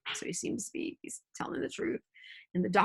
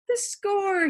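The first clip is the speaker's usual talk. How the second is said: sudden high, louder exclamation; bursting out; 11 semitones higher, 4 dB louder than usual. A narrator's reading. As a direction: blurt it out as if just remembered. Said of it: "he burst out".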